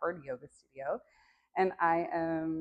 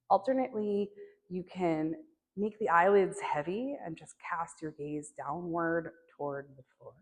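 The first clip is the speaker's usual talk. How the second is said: measured meditation teacher voice with spacious pauses; guided meditation; 2 semitones higher, 4 dB louder than usual. Acted. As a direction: gently guide them into their body, pausing between phrases. Begins leading guided meditation.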